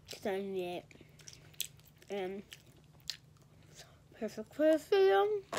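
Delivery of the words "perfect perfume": put-on voice. Silly voice mid-vlog.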